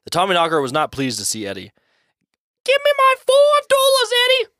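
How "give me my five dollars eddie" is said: very high, loud cartoon ghost voice; demanding ghost. A narrator's reading. Old friend's ghost demands his money back from Eddie, high demanding squawk. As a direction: squeal out the demand like a little goblin. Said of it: "the ghost of his old friend demanded".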